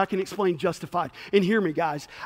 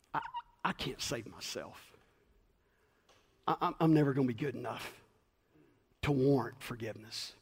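lower, much softer broken admission; humble confession; 6 semitones lower, 9 dB quieter than usual. Retold or imitated. A believer quietly admitting he can't save himself. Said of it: "where you realize".

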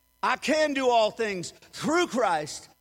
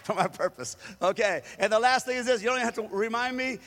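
ordinary talk, nothing marked

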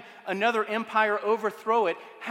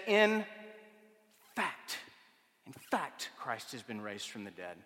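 much softer, dropping low by "Christ has been raised"; hushed retelling; 9 semitones lower, 9 dB quieter than usual. Shown as a demonstration. Preacher repeating Paul's words softly to the congregation, hushed. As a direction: let "in fact, in fact" fall quiet and intimate. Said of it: "He says".

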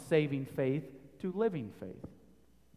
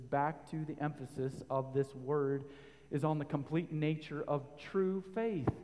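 ordinary talk

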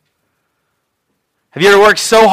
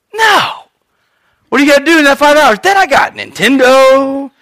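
put-on whiny kid; 7 semitones higher than usual. Young self arguing with dad.

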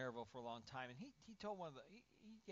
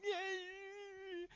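high, drawn-out mock wail; imitated baby cry; 6 dB louder than usual. Baby who fell while learning to walk, mock crying. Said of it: "he's crying".

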